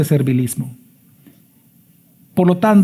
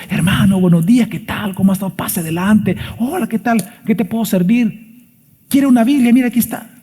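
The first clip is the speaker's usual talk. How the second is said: lightly voiced friendly host, higher; a hint of an eager church greeter; welcoming churchgoer; 5 semitones higher than usual. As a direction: play a cheerful usher greeting a guest. Acts out greeting a visitor at church.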